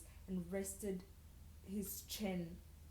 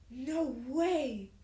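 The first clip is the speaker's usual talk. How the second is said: louder, higher shocked voice; 5 semitones higher, 7 dB louder than usual. A narrator's reading. Sasha hearing who her friend's date is, shocked. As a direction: say it in stunned disbelief. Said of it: "she says with shock".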